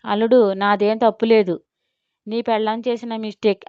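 ordinary delivery, nothing marked

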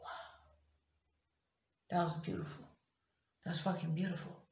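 very soft, marvelling undertone; hushed awe. Retold or imitated. Woman reliving pain suddenly gone, hushed awe. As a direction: breathe it out in quiet relief.

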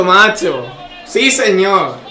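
usual speaking voice

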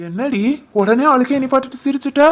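words spilling through laughter; husband laughing at wife who stopped crying; laughing happily. Acted. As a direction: laugh along with her.

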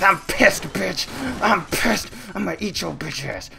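hammed-up monster anger; mock rage. Monster raging at prey.

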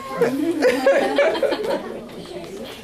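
light laugh. Real, not acted. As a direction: laugh warmly. Laughs at story.

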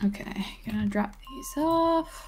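soft whispered aside; ASMR whisper. Real, not acted. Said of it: "Why are you doing A S M R now?".

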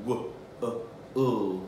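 "W, uh, uh" as separate soft sounds; soft, spaced phonemes "W", "uh", "uh"; sounding out. Shown as a demonstration. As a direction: segment "W, uh, uh" with gaps. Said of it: "do that again".

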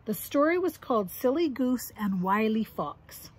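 usual speaking voice

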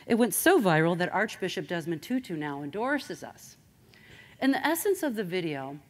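ordinary talk

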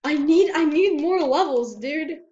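high, loud insistent complaint; urgent pleading. Real, not acted.

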